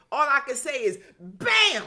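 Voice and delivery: loud, high exclamation; excited. Real, not acted. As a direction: shout it out with joy. Enthusiastic response to message.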